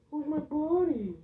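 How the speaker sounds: high, slow coo; slow pet talk. Real, not acted. Owner cooing slowly to his hamster. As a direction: croon to the tiny pet.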